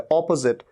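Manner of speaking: अपोजिट as "AW-puh-zit"; correct opposite. Shown as a demonstration. Teacher modelling "AW-puh-zit". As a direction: open अपोजिट with a stressed o. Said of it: "अ नहीं".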